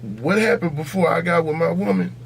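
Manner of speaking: usual speaking voice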